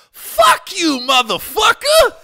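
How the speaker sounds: high, loud fake-angry shout; mock furious yell. James' wife snapping at the drunk joker, loud high shout. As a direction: bark it back at him, hammed up. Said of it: "And she goes".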